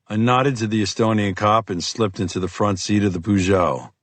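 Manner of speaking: plain reading voice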